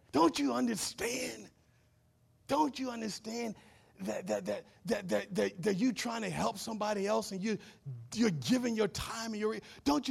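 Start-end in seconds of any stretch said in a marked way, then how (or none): none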